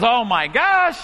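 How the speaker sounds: retold burst of joy; overjoyed mother. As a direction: imitate her delighted surprise, slowly. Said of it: "she starts so happy".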